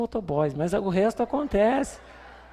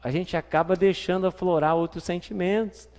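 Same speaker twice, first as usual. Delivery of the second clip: usual speaking voice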